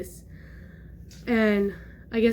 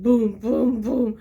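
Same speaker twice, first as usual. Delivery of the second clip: booming stomp noises; imitated heavy footsteps; 5 dB louder, much slower than usual. Describing own loud footsteps nowadays, imitated booms. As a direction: voice each thudding step. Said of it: "my footsteps are, like".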